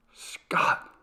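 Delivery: whispered, breathless and urgent. A narrator's reading. Frightened wife gripping her husband's arm, urgent whisper. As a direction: hiss his name in fear.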